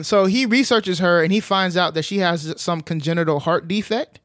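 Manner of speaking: ordinary talk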